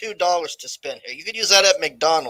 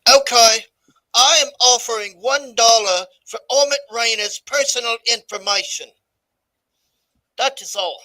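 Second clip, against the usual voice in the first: Orange Tyrant bit; 5 semitones higher than usual. Pretend. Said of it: "Orange Tyrant bit".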